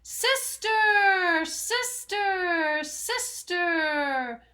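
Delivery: the cave answering back; cave echo. Acted. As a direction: fade each repeat away. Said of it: "a voice from the cave came back".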